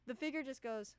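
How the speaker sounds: ordinary talk, nothing marked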